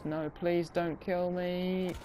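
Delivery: high, slow, whiny begging for mercy; hammed-up plea to spare his life; mock pleading. Gamer begging the enemy soldier not to kill him, hammed-up whine.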